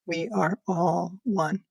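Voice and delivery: very slow, hushed and deliberate; solemn message. Retold or imitated. Relaying her mother's last message, slow and solemn. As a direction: lay each word down slowly, like a revelation. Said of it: "her third sentence was".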